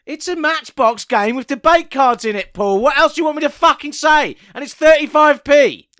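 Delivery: loud, fed-up outburst; exasperated shout. Real, not acted. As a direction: yell it in exasperation.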